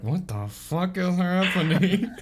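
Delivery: high, drawn-out disbelief with a laugh; mock bewilderment. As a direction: stretch it out as stunned, comic bafflement. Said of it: "I love how everybody's frozen".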